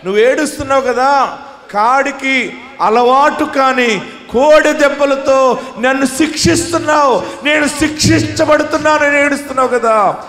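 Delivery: loud, high preaching at full force; fiery preaching. Acted. Preacher telling the weeping faithful God hears them, fiery. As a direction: rouse the hall.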